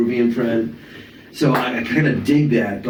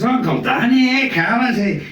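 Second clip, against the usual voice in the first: much higher Glasgow uncle voice; Scottish uncle; 11 semitones higher than usual. Retold or imitated. Scottish uncle greeting his son's friend, in a high Glasgow accent. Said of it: "parents are from Glasgow, Scotland".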